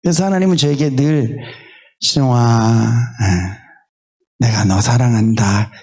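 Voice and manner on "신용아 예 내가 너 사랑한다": gently voicing a loving God; tender loving God. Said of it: "이러면서 저에게 늘 다가오시거든요".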